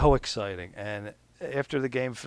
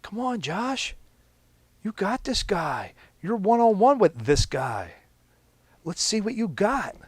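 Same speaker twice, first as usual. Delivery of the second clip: higher, louder fired-up encouragement; passionate cheering; 11 semitones higher, 7 dB louder than usual. Retold or imitated. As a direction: pump up a teammate one-on-one. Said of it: "That's how passionate T J is".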